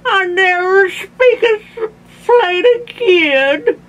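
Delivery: high, tearful toothless wail; slurred, hammed-up old man's lament; moaning Grandpa. A narrator's reading. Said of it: "moaned Grandpa".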